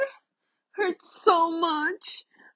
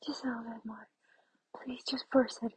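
whispering; 5 semitones lower, 10 dB quieter, faster than usual. Acted. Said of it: "pleading whisper continues".

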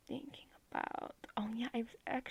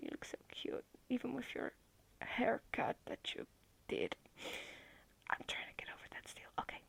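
whispering over a crib; 3 semitones higher than usual. Acted. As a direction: hush them gently to sleep. Hushing partner to sleep.

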